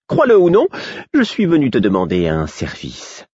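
the fool. Acted. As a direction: blurt it out, high and theatrical. Fool's sudden request.

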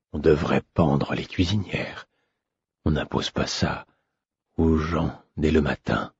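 a hint of a trembling old man, soft and slow; lightly voiced weary prince, muttering his complaints; hesitant old man. A narrator's reading. Weary prince grumbles to his young servant, low and hesitant. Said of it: "Il parlait d'un ton hésitant".

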